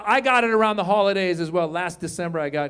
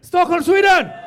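high, loud, whooping shout; hyped-up echo; 10 semitones higher, 7 dB louder than usual. Acted. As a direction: whoop the hometown back. Echoes audience member in hyped shout.

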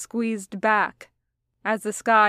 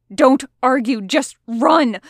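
loud, sharp, urgent snap; impatient shouted command; snapped order; 4 semitones higher, 8 dB louder than usual. A narrator's reading. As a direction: snap it out loudly, no debate allowed. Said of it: "snapped Roman".

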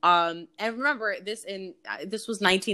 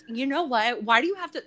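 put-on higher, snippy teen retort; teen snapping at stepdad, higher and snippy; bratty snap; 7 semitones higher, faster than usual. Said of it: "total bitch mode immediately".

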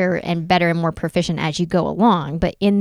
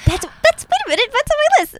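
very high-pitched, eager interjection; real excitement. Real, not acted. Friend asks about DIYs already on her list, real excitement. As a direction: jump in squeaky-high with excitement.

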